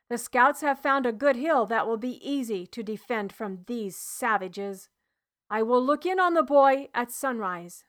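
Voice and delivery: a firm soldier giving a warning, only slightly put on; stern centurion. Centurion gives orders, then leaves.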